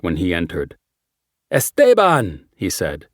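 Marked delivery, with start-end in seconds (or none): 1.5-2.5 loud warm Spanish greeting; hearty Spanish-accented hello. A narrator's reading. Lawyer greets warmly.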